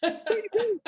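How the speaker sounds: high, giggling repeat of the question; can't get the words out for laughing; laughing through it. Retold or imitated. Repeating his line while laughing.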